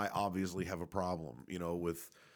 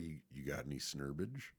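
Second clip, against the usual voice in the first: low, quiet retold question; imitating mom's sly offer; 5 semitones lower, 6 dB quieter than usual. Mom quietly offering her kid weed, low and hushed.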